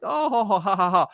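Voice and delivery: high, put-on string of ha-has; imitated nervous laugh. Salesman hoping the angry owner is joking, nervous mock laugh. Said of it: "I got nervous because I thought maybe he was joking".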